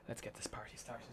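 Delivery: a breathy whispered aside; whispering. Real, not acted. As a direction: whisper it under your breath. Quiet aside while starting up.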